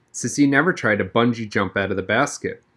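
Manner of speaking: usual speaking voice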